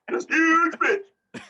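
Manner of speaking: very high, loud, drawn-out mock cry; slow, squealing comic topper; hammed-up exclamation. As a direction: stretch it out high and loud for the joke. Topping the running joke.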